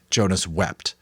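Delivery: usual speaking voice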